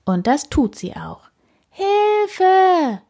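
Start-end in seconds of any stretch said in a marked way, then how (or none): 1.7-3.0 high, drawn-out call for help. A narrator's reading. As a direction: call out from up the tree. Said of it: "ruft sie".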